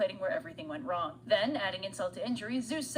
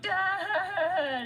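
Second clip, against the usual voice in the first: higher, louder, joyful shout; overjoyed cry; 9 semitones higher, 5 dB louder than usual. Retold or imitated. Telemachus greets his returned father, loud happy cry. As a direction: call out like a son reunited. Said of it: "Telemachus is like".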